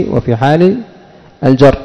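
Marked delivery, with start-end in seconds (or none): none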